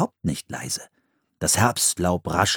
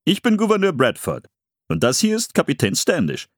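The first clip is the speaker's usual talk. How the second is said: formal character voice, pitched up; Gouverneur Bradford; 7 semitones higher than usual. A narrator's reading. Governor introducing himself and the captain, formal welcome. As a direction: introduce yourself and your companion with ceremony. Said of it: "begrüßte sie der Mann".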